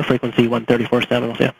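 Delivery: ordinary delivery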